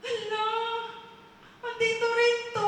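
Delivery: high-pitched dismay; acted shock. Man finding another secret exposed, shocked. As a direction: exclaim high, caught out.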